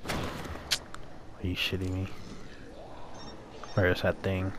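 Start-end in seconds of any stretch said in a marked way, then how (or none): 0.1-2.1 hushed whisper. Real, not acted. Disbelief at game threat.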